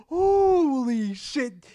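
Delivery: gasp, then slow high "holy shit"; stunned at how fast it escalated; shocked. Real, not acted. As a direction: gasp in disbelief.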